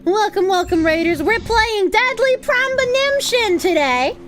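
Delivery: high, excited raid welcome; bubbly high-pitched greeting; cute anime streamer. Real, not acted.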